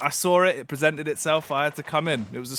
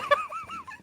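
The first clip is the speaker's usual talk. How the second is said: high burst of laughter. Real, not acted. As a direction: crack up laughing. Laughing at the goof.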